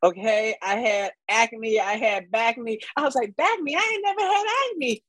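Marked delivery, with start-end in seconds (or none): 3.3-5.0 incredulous high-pitched retort. Retold or imitated. Mocking her own mishearing.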